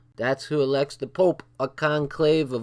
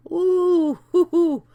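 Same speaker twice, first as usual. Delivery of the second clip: shrill falsetto whoop of shock; high whooping ooh; 8 dB louder, slower than usual. Real, not acted. Reacting to the bomb story.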